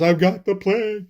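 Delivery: high-pitched panicked wail; mock terrified. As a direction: act out a man in panic. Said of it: "So just, like, terrified".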